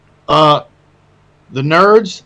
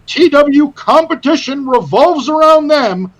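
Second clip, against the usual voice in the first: wrestling announcer voice; 6 semitones higher than usual. Acted. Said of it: "You sound like a W W E announcer right now".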